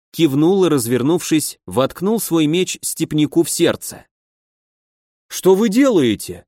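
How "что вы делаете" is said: high, frightened squeal; shrieking. A narrator's reading. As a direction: squeal it in sudden panic. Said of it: "вдруг заверещал полусотник". (испуганный визг)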